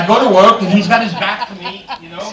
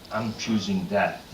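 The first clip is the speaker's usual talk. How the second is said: dry resignation; 8 semitones lower, 8 dB quieter, slower than usual. Retold or imitated. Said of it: "I said".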